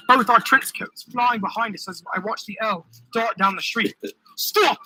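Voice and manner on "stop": high-pitched cry to halt; yelled. A narrator's reading. Marquis chasing the fleeing Earl, yelling. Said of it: "Marquis yelled".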